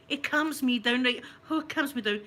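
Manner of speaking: ordinary talk, nothing marked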